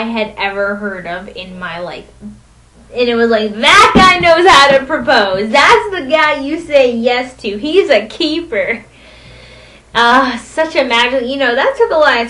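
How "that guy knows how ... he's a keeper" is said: gushing approval. Retold or imitated. Crowd approving the proposal.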